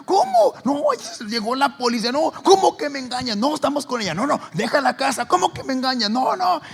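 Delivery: husband and sisters shouting at each other; heated quarrel. Retold or imitated. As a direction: act a shouting match.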